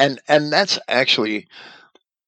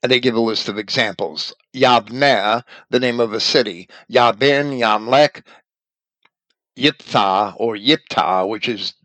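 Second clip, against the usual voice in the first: normal talking voice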